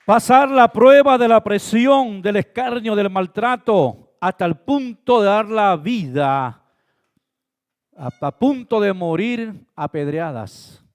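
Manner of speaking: building sermon climax; fiery preaching. Acted. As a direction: preach it with full force. Preaching at full force.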